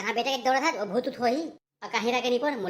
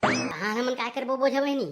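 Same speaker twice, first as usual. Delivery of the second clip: ordinary delivery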